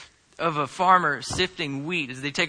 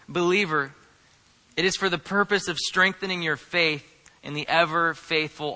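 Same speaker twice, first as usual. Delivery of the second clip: usual speaking voice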